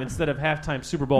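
ordinary talk, nothing marked

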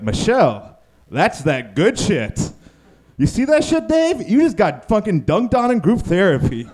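high excited trash talk at Dave; gleeful hype. Retold or imitated. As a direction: gloat like a courtside fan. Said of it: "But internally, we were like".

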